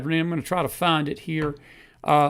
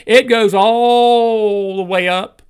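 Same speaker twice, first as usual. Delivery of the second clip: stretched, louder and higher; slow loud build; 4 semitones higher, 7 dB louder, much slower than usual. Real, not acted. Host to viewers, slow rising shout. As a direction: drive it home slowly. Building rant about corruption.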